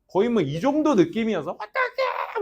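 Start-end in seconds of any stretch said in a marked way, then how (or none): 1.5-2.4 high whimpering wail; a mimicked high sob; crying voice. Teacher imitating a student crying on the phone, high whimper. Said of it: "막 하면서 막".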